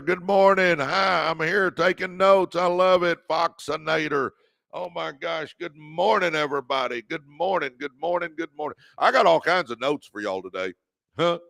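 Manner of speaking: ordinary delivery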